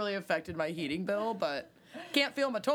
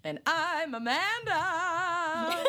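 hammed-up intro; 10 semitones higher, much slower than usual. Exaggerated drawn-out intro.

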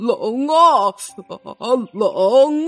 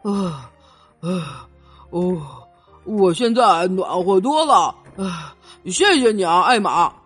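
low, panting elephant voice; out of breath; 7 semitones lower than usual. A narrator's reading. Elephant thanks Emma after climbing the steep path, out of breath. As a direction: puff between phrases after a steep climb. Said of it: "很快就走得上气不接下气了".

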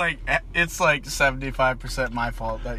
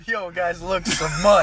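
excited shout; 5 semitones higher, 5 dB louder, slower than usual. Pretend. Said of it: "I never pass up on an opportunity to say mud".

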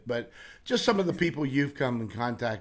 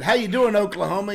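loud, higher retold hello; hearty greeting; 6 semitones higher, 7 dB louder than usual. A showman greets a carnival worker, loud and hearty. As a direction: greet him big and friendly.

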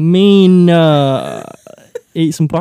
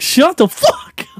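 slow, higher, louder annoyed retort; annoyed snap; 11 semitones higher, 5 dB louder, slower than usual. Retold or imitated. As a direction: snap at the talkers. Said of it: "stand up and be like".

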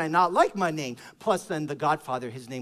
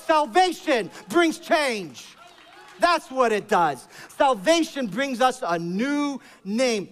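slow, loud, higher proclamation; emphatic preaching; 6 semitones higher, 4 dB louder, slower than usual. Real, not acted. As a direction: hammer each word home.

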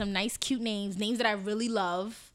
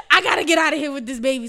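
much louder, higher and fast; exasperated mother; 5 semitones higher, 13 dB louder, faster than usual. Retold or imitated. Mother at the hospital wanting to leave, loud fast exasperation. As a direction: blurt it out like she's done with all of it.

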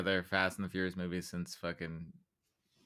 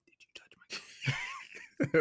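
menacing whisper up close; quoting a rich kid's creepy whispered threat; much faster than usual. Retold or imitated. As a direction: breathe it right in his face. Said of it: "gets really close to him and whispers".

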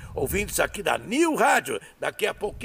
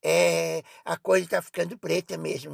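normal talking voice

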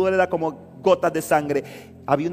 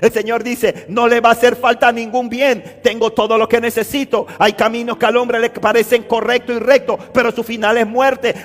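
loud, raised sermon voice; full-force preaching; 8 semitones higher, 9 dB louder than usual. Real, not acted. Preacher tells believers God gives what they need, preaching forcefully. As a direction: preach it out to the whole congregation.

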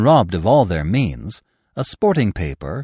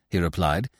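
plain reading voice

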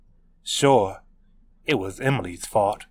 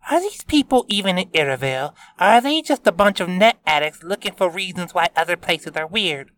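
Taylor voice; 8 semitones higher, 4 dB louder, faster than usual. A narrator's reading. Taylor skeptical, arms crossed.

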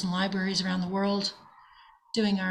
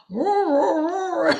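high, loud playful dog roaring; imitated dog roar; 8 semitones higher, 7 dB louder, much slower than usual. Owner imitating her dog trying to talk like Miko, loud high roar. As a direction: bark it out like a dog copying a husky. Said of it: "She'll try to talk like Miko".

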